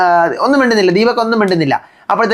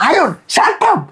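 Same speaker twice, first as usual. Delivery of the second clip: much higher squeaky mock "Arun, shut up"; mock outburst; 10 semitones higher, much slower than usual. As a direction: squeal it to cut Arun off. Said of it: "സൗന്ദര്യം". (അരുണിനോട് കീച്ചിയ ശബ്ദത്തിൽ shut up)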